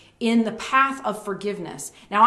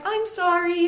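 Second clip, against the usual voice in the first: put-on light child's apology; 9 semitones higher, slower than usual.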